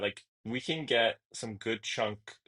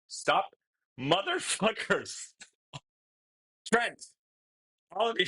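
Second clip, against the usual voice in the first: high, giddy pleading with bursts of laughter; real flustered, high-pitched whine; overwhelmed protest; 7 semitones higher than usual. Real, not acted. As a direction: beg them to stop, half laughing. Overwhelmed by more donations, begging chat.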